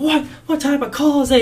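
high-pitched mock indignation at a bad call; mock outraged protest. Batter turning on the umpire, in high mock outrage. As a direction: imitate a batter blowing up in disbelief. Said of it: "He starts cussing out this guy".

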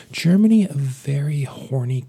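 sudden high loud shout. Acted. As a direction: blurt it out like a proclamation. Mock proclamation about German listeners.

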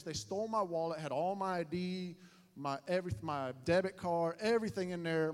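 usual speaking voice

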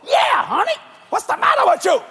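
hammed-up wife scolding her husband; wife snaps at husband over the car. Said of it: "and his wife caught it".

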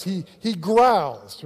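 drawn-out, slightly growled word; a hint of a growl, very slow; mild growl. Shown as a demonstration. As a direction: stretch 'growls' like a beast. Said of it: "this is how Satan does".

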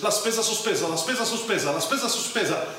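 rattled off quickly; fast and furious. Shown as a demonstration. Teacher drilling the phrase fast and furious for learners. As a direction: zip through it fast. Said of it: "Fast and furious".

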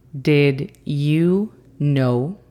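very slow careful English repeat. Shown as a demonstration. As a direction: say it again slowly. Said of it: "Y esa frase es".

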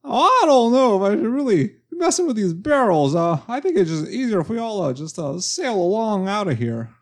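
high, jittery nervous man voice; nervous Don Knotts. Pretend.

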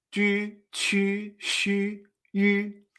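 Chu, chu, shu, yu, each U said as French Ü; U con diéresis. Shown as a demonstration. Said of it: "es como si esa U tuviera diéresis". (Chu, chu, shu, yu con Ü)

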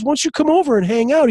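quick, high-pitched casual invite; lightly voiced buddy, breezy and fast; Kevin Hart. Comedian inviting an old friend over, lightly voiced and breezy. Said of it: "Kevin Hart messages him back".

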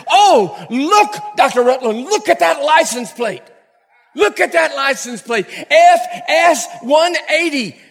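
pointing it out, thrilled; mock excited driver; excited exclaim.

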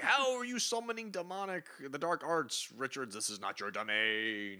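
high theatrical indignation, settling down; hammed-up villain tirade; Doctor Doom outraged. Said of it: "And Doom's like".

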